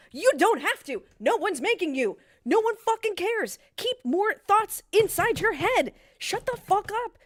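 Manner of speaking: loud, emphatic telling-off; angry rant. Real, not acted. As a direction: rant it loudly, leaning on each word. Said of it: "Sorry, I was on a rant".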